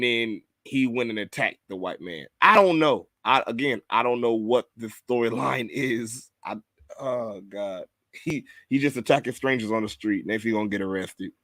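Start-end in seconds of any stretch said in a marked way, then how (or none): none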